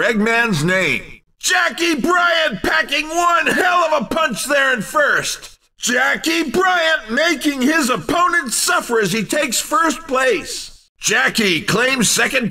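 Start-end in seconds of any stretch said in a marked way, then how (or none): 1.4-10.9 high-pitched, punchy victory commentary; excited winner call. Acted. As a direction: hype up the race winner. Announcer celebrates a first place.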